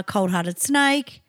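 ordinary delivery

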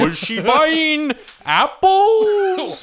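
high, slow, dopey manatee voice. Pretend. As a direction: drawl it like a slow-motion sea cow. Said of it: "He was doing a manatee voice".

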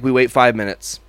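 ordinary talk

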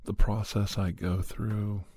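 normal talking voice

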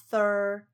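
thir held long and emphasized; drawn-out THIR. Shown as a demonstration. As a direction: stretch thir out firmly. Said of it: "比较强调". (thir 念得长而强调)

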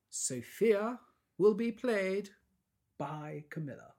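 high, slow grand announcement; dramatic announcer. Pretend. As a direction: announce it like a big reveal.

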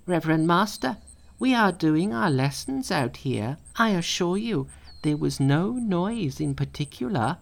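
high, sweetly innocent monkey; high innocent excuse. A narrator's reading. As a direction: talk your way out of trouble.

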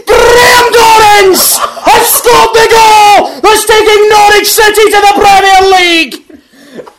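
roaring goal commentary, building to a screaming climax; football commentary. Pretend. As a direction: call the winning goal like a frenzied commentator. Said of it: "Something along those lines".

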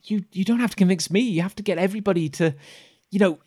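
put-on high-pitched earnest child voice; pleading kid. Kid urging a doubter to convince everybody, high and earnest. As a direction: speak up as an eager, stumbling kid. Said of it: "The kid".

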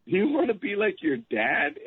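a lightly voiced teasing wife; imitating wife. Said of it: "She goes".

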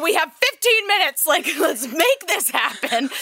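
high-pitched excited scheming, retold; rally your partner with a laugh, mock urgent; playful urgency. Retells hurried plan for stolen moment.

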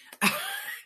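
quick, high, airy laugh; breathy laugh. Real, not acted. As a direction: laugh at your own sneaky segue. Laughs at her own segue.

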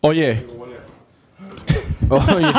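normal talking voice

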